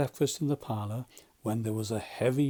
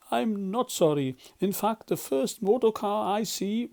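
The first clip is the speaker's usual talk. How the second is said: defiant Toad; 8 semitones higher, 4 dB louder than usual. Acted.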